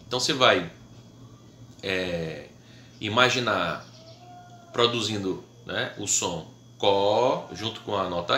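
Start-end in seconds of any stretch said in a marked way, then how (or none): none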